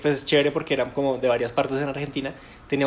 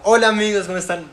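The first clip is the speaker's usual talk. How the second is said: lightly voiced mock youtuber hello, a bit higher; youtuber greeting; 6 semitones higher, 4 dB louder than usual. Mocking youtubers who greet fans, a light youtuber voice. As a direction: parody a bubbly vlog opener. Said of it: "subía muchos videos así como".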